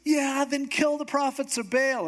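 high, carried-away order; hammed-up rallying cry; excited command, imitated. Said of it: "they're so excited that he goes".

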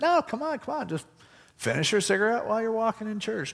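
hammed-up pastor pleading; insistent pastor. Pastor voiced insisting, high.

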